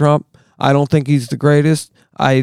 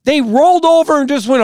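much higher, strained, furious disbelief; angry disbelief; 12 semitones higher than usual. Real, not acted. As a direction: spit it out, can't believe it. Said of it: "I'm sorry I'm angry".

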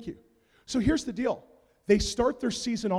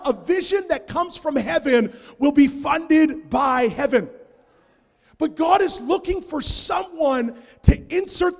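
loud, slow, rising preacher's proclamation; preaching at full force; 7 semitones higher, 5 dB louder than usual. Acted. Pastor rallying the congregation, loud preaching.

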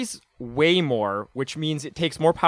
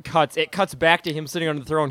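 usual speaking voice

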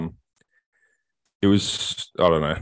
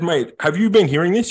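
indignant retort; 10 semitones higher than usual. Retold or imitated. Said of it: "I said".

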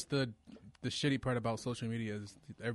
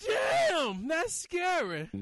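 high eerie spooky intro voice; jack o lantern voice; 6 dB louder, much slower than usual. Acted. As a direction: ghoulish show-title cackle voice. Show title sting, spooky.

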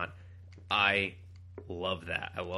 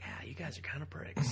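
hushed whisper; whispered aside; 5 semitones higher, 6 dB quieter than usual. Retold or imitated. Fan whispering a grumble about the mutants. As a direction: breathe it like a muttered secret.

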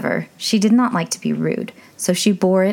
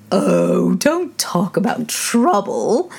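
lightly voiced old duchess; a hint of a fussy old duchess; frail withering duchess; 2 semitones higher, much slower than usual. A narrator's reading.

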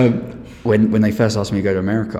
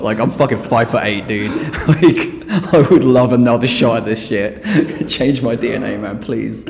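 louder, laughing through a joke; real laughter under sarcasm; laughing sarcastically; 5 dB louder than usual. Real, not acted. Laughs while joking about vaccine.

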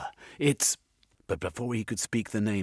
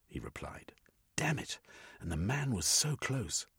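plain reading voice

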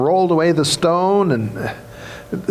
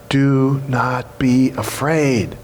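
slow reassurance; 3 semitones lower, much slower than usual. Shown as a demonstration. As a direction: let every word land gently. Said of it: "first thing you need to hear".

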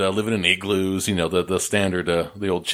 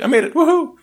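much higher and louder, laughing cheer; triumphant woohoo; 6 dB louder than usual. Retold or imitated. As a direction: crow it out, squealing with glee. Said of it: "I'm like".

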